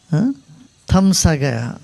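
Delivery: ordinary talk, nothing marked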